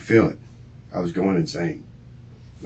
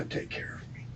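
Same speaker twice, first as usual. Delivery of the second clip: whisper; 21 dB quieter than usual. Real, not acted. Confessing the killing to police.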